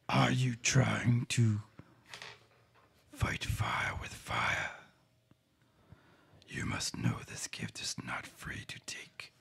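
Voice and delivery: eerie ancient whisper of a dark power; gravelly whisper. Acted. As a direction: hush it out like a creeping voice from the shadows. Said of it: "things got a little quiet and a little gravelly".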